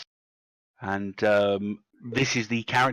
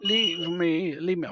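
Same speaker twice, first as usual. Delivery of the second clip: moaning elf; 6 semitones higher, slower than usual. Acted. Injured elf begging to be left.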